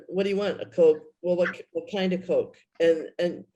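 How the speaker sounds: ordinary talk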